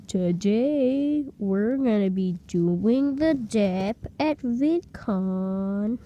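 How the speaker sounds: drawn-out, sing-song vlog intro; mock vlogger. Vlogger greeting his viewers at VidCon, exaggerated drawl. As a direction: stretch every word like a parody vlogger. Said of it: "he started recording a video, just right there, just a shitty vlog, and he went".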